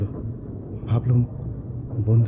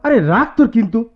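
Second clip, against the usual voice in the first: loud, higher cutting-off; impatient retort; 11 semitones higher, 7 dB louder than usual. Acted. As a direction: shut him up and go. Cutting off friend's objection. (বিরক্ত হয়ে ধমক)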